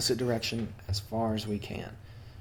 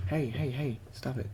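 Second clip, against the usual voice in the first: hushed, much softer aside; soft scolding; 2 semitones higher, 9 dB quieter than usual. Real, not acted. Player to a misbehaving cat, soft scolding. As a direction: quietly tell a pet off.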